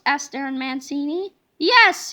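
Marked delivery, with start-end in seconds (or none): 1.6-2.1 loud, short yell; teacher yelling an answer, loud; yelled yes. Acted. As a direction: shout the yes at the kid. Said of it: "yelled Miss Ribble".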